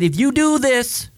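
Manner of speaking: lifted high and slowed, a lightly voiced God warning; a hint of a commanding God. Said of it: "And he said".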